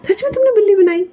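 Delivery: high, amused question; amused disbelief. Acted. Lanza teasing Wing about building a cat, amused and high. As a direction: ask it grinning, pitched high. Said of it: "मुझे हंसी आ रही है".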